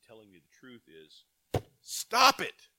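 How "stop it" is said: high, loud shout of Stop it; preacher shouting God's warning to sinners, loud and high; sharp stop command. Acted. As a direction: bark the command like God's rebuke. God's blunt message voiced.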